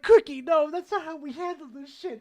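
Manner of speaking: telling Cookie off after the shooting; exasperated scolding. Retold or imitated. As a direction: scold a reckless friend. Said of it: "I'm like".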